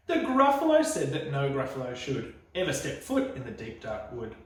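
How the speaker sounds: usual speaking voice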